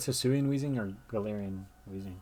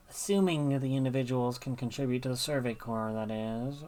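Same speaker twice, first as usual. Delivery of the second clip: Cillian; 2 semitones higher than usual. Acted. Captain introducing herself to a newcomer, put-on character voice. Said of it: "I'm not gonna do that voice anymore".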